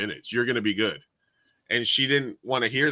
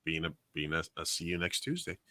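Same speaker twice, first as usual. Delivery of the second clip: much softer, under the breath; muttered insult; 6 semitones lower, 12 dB quieter than usual. Retold or imitated. Bartender quoting the curse she swallowed, softer. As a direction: mutter the insult low so nobody hears.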